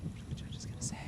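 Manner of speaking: hushed suspense whisper. Pretend. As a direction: breathe it in a hush. Waiting for the judges' verdict.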